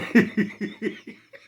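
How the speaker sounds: high-pitched, loud cackling giggle trailing off. Real, not acted. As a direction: laugh high and long at your own joke. Laughs after teasing about a secret.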